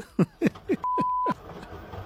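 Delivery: high-pitched laughter; amused high laugh; real laugh. Real, not acted. Host laughs after reacting to caller.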